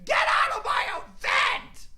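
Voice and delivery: high, loud shouted "Get out of my event!" rising to a shriek; mocking shout. Mocking Keemstar to viewers, shrill shouted impression. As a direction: ham up a furious host yelling. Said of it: "This is what he said".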